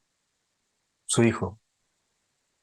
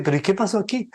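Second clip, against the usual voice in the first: surprised disbelief; 8 semitones higher than usual. Retold or imitated. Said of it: "el tipo se queda sorprendido".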